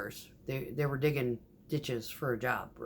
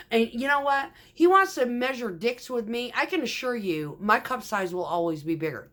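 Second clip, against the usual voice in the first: higher, louder furious rant; angry venting; 7 semitones higher, 4 dB louder than usual. Retold or imitated. Retelling the rant to the crew, heated and loud. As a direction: let it all out at the guy who insulted you. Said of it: "and I'm venting".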